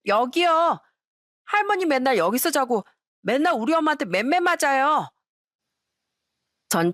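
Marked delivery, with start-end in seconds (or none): 0.1-5.1 put-on high, innocent little-kid voice; a small child asking grandpa; grandchild's voice. Grandson asks grandpa about sleeping there, in a high child voice. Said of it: "첫째 손주가".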